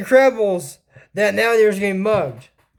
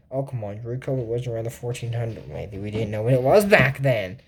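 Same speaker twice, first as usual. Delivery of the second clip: quiet aside; 12 semitones lower, 6 dB quieter than usual. Real, not acted. Self-correcting aside, low and quiet.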